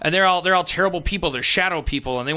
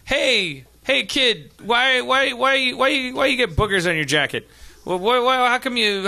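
lightly voiced pestering kid, higher; playground kid; 6 semitones higher, slower than usual. Playground kids questioning him, lightly imitated higher kid voice. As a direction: badger with a hint of childish whine. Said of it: "when I was on the playground".

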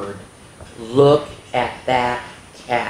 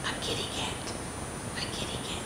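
breathy hushed whisper; 17 dB quieter, much faster than usual. A narrator's reading. Storyteller whispers the cat's arrival to the kids. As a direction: lean in and whisper it. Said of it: "whispered to children".

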